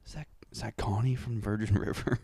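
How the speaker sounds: hushed surprised whisper. Retold or imitated. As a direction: whisper it in disbelief. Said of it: "we both looked at her and were like".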